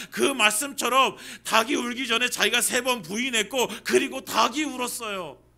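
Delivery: loud, driving sermon delivery; fervent preaching. Acted. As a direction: preach it with full conviction. Preaching at full force. (열정적으로 힘차게 설교하듯)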